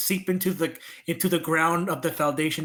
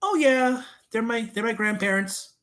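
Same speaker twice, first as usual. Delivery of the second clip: lightly voiced chirpy stranger, higher; friendly young woman; 6 semitones higher than usual. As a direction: answer in a light, higher imitation. Voicing the friendly third person.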